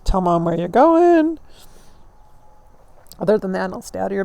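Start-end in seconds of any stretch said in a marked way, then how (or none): 0.0-1.4 mock instruction. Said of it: "I trained my children well".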